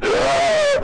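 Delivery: loud, high startled gasp; sudden sharp shocked gasp. Real, not acted. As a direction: gasp sharply in real fright. Sudden fright in horror game.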